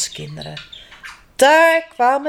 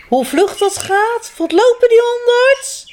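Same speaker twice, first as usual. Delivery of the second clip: excited grandma; 11 semitones higher, 4 dB louder than usual. Acted. Grandma marvels at the sled dogs, high excited call. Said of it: "riep omaatje".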